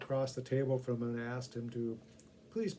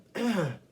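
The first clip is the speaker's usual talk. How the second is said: throat clearing; 6 semitones higher, 7 dB louder than usual. Acted. Coughing from pepper.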